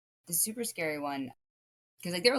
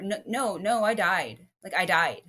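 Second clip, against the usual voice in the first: louder, firm contradiction; emphatic insistence; 3 semitones higher, 7 dB louder than usual. Retold or imitated. Dreamer contradicting everyone who says otherwise, emphatic insistence. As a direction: push back hard on the doubters.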